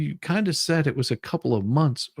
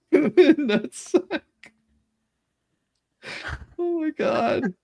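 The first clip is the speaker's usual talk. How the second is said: squeaky laughing, barely getting it out; laughing hard; much slower than usual. Real, not acted. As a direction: lose it laughing on every word. Host cracking up at the joke.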